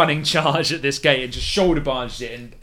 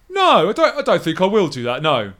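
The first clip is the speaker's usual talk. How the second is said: high, loud guard voice turning it down; guard declining the request to open the gates; guard refusing; 6 semitones higher, 5 dB louder than usual. Acted.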